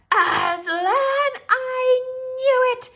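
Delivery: high, loud witch outburst; furious shriek. A narrator's reading. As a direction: explode in rage. Witch bursts out in rage.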